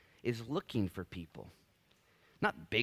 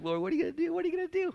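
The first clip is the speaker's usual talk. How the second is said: very high-pitched, eager acted questioning; super excited; 3 dB louder, much faster than usual. Believer asks the Lord what is next, super excited. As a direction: climb up high like an overexcited kid pestering. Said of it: "super excited about the Lord doing stuff".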